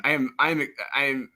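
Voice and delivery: ordinary talk, nothing marked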